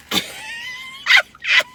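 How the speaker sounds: a sudden high squeal of laughter. Real, not acted. Vlogger squeals with laughter at her cut cookie.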